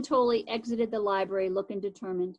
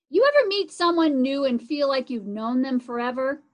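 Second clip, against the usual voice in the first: plain reading voice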